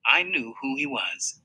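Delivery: ordinary delivery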